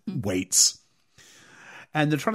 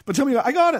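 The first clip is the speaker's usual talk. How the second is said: panicked urgency; 11 semitones higher, faster than usual. Retold or imitated. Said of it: "I'm like".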